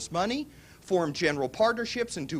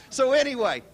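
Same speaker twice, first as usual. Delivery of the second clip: usual speaking voice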